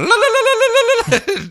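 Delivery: high, loud, trilled "la-la-la" battle yell; shrill-pitched rapid warble of a comic attacker; wild war cry. Retold or imitated. Huntress charging at Superman with knives, wild war cry. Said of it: "Huntress is just going".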